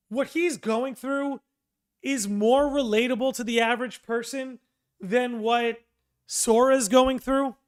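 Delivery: ordinary talk, nothing marked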